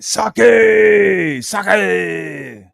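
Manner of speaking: high, loud drawn-out shout; movie-style yell. Acted. Streamer recalling a samurai film, drawn-out mock yell. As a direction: holler it, stretching the last vowel.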